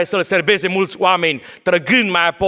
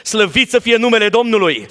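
preaching; 5 semitones higher than usual. Acted. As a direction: preach it out to the congregation. Preaching at full force.

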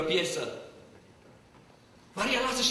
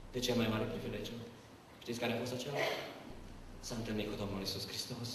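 much softer, lower, solemn; hushed reverence; 9 semitones lower, 8 dB quieter than usual. Real, not acted. Preacher hushes the church for the climax, softly. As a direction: draw them in with a hush.

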